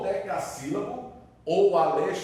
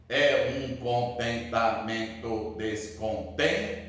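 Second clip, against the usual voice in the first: slow, lower metrical count; syllable-by-syllable; 4 semitones lower, much slower than usual. Shown as a demonstration. Teacher measuring a Camões verse for students, syllable by syllable. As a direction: give every syllable its own even beat. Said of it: "dez sílabas".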